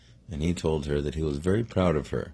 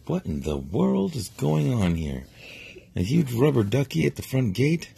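raised, louder amazed princess; surprised princess; 8 semitones higher, 3 dB louder than usual. Acted. Parent telling bedtime story, princess amazed at gate. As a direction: gasp at the giant duck at the gate.

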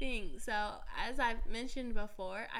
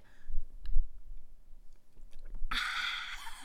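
exaggerated mouth sounds; 7 dB louder than usual. Shown as a demonstration. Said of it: "I'll exaggerate it".

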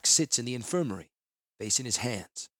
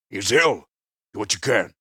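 terse Tahngarth; 8 dB louder than usual. Acted. Tahngarth speaks to the healer.

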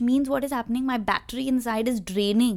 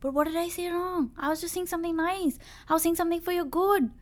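higher, fast, wounded innocent voice; mock hurt protest; 7 semitones higher, faster than usual. Husband protesting to his wife that he meant well, higher and fast. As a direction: act out the puzzled husband defending himself. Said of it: "But then she'll be like".

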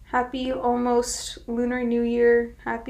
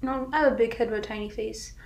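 ordinary talk

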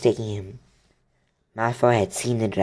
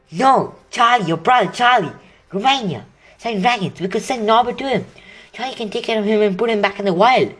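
excited plan; 9 semitones higher than usual. Acted. Harry excitedly explaining plan.

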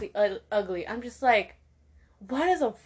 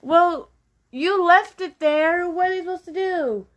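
high, loud, exasperated comeback; loud retort; 8 semitones higher, 6 dB louder than usual. Real, not acted. To iPhone users who lost their phones, a loud exasperated retort. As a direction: throw it back at them, loud and high.

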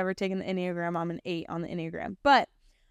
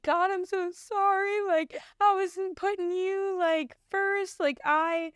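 much higher, breaking, tearful voice; tearful apology; 10 semitones higher, slower than usual. Retold or imitated. [name] apologizing to God at the field, tearful and pleading. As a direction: plead through rising tears. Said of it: "I just start breakdown crying".

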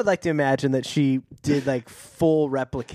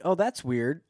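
mock casual; 3 semitones higher, slower than usual. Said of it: "how are they not like".

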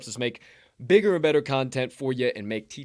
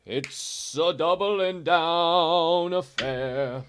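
announcer call; 6 semitones higher, 5 dB louder, much slower than usual. Acted. Hosts hyping the pick.

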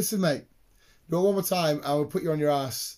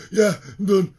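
louder, higher gasped surrender; breathless lad; 5 semitones higher, 11 dB louder than usual. Retold or imitated. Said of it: "He's like".

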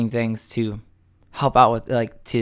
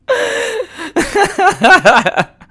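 long, high, loud laughing fit; laughing hard; 8 dB louder than usual. Real, not acted. Man cracking up over his cat story, laughing. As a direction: crack up till it squeaks.

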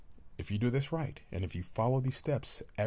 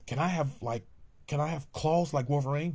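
put-on higher, louder excited kid; child voice; 6 semitones higher, 3 dB louder than usual. Child asking daddy about Spider-Man, high and eager. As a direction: ask it like a thrilled little boy.

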